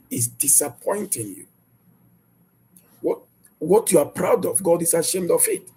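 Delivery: ordinary talk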